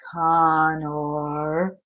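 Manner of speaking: low, drawn-out monstrous call; monster calling. Acted. The monster calling Connor from the dark, drawn out. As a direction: call his name like something vast outside.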